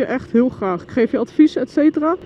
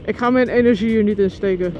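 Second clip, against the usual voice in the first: ordinary delivery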